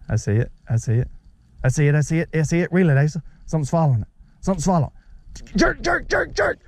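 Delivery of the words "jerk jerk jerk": sharp high calls of jerk; urgent high call. Real, not acted. Dad urging his son to jerk the rod, excited.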